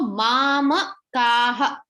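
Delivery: "mamakaha" slowly, with drawn-out aa in maa and kaa; slow syllable-by-syllable "mamakaha"; long A vowels. Shown as a demonstration. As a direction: stretch the dashed vowels of "mamakaha". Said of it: "whenever there is a dash over A".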